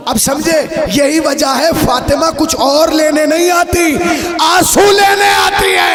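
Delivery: loud, slow, high climax of a sermon; preaching at full force. Real, not acted. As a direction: build to a ringing, drawn-out peak.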